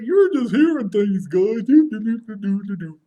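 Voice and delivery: high, loud mock-innocent brush-off; acted dismissal trailing into silly doo-doos; playing it off. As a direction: brush it off in a high, singsong put-on voice. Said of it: "he starts playing off like".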